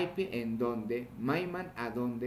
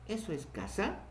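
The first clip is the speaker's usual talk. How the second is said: "eso es casa" rising high on "casa"; questioning tune; 6 semitones higher, 3 dB quieter than usual. Shown as a demonstration. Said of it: "Con eso podemos interrogar".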